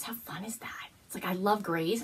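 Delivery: whispered, very soft; hushed delight. Real, not acted. Admiring gray yarn up close, hushed whisper. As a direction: breathe it like a secret.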